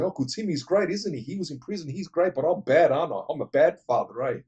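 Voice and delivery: high, loud mocking sarcasm; a hammed-up bitter dad, loud and high; sarcastic rant. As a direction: throw her words back at her bitterly. Father sarcastically ranting at daughter.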